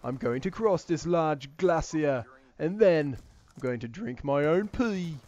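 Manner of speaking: slow, emphatic British survival commentary; dramatic British adventurer impression; Bear Grylls. Shown as a demonstration. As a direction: announce it like a British wilderness host. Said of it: "I can make a pretty good Bear Grylls comparison".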